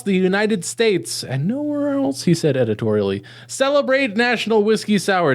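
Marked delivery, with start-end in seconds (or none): 2.2-3.2 quick aside. Acted.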